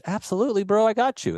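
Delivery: high-pitched, eager yes; eager retold agreement. Retold eager reply to friend.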